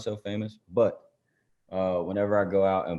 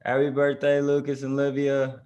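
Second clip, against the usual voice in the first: raised, louder celebratory greeting; birthday shout-out; 7 semitones higher, 5 dB louder than usual. Real, not acted. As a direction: call out a birthday wish to the twins. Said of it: "say happy birthday to them".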